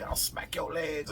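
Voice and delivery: high imitation of a scolding old woman; Betty. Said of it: "she used to say".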